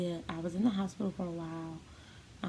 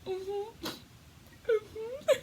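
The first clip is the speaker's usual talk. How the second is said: high, tearful sobbing hums; retold breakdown, voice cracking high; crying. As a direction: choke out the words through tears. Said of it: "I just broke down crying".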